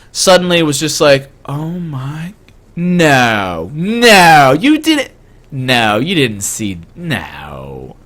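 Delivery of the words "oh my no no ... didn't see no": pitch-swooping voice of an embarrassed mother in denial; mortified mom. Retold or imitated. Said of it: "deep shade of red".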